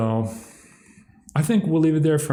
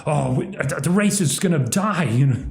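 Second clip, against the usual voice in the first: higher, hammed-up alarm; mock the doomsayers in a panic; higher mock panic about the race dying; mock panicked activist; 6 semitones higher than usual. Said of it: "Ah, just like".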